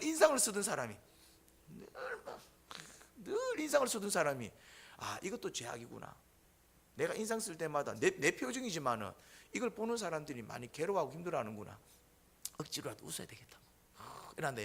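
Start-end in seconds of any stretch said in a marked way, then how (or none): 1.7-2.4 breathy unvoiced aside; whisper. Real, not acted. Preacher slipping a whispered aside to the congregation. 5.0-6.2 softer, lower, dawning inner thought; quiet realization. Retold or imitated. Frowning man realizing his sin, quiet. 12.6-13.6 unvoiced, soft inner vow; whispered resolve. Man resolving to smile, whispered to himself.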